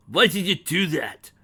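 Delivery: loud raging demand; rage. Acted. Dragon raging at the mare who drowned her son, loud demand. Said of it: "raged the dragon".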